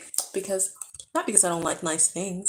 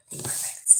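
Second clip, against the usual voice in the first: hushed whisper; whispered echo; 8 dB quieter than usual. Real, not acted. Vlogger echoing her hope for the inspection, whispered. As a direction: whisper it like a secret wish.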